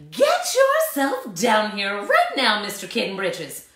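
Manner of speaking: loud commanding mom; bossy mother voice. A narrator's reading. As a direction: order him downstairs, firmly. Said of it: "ordered Mama Junebug Jones".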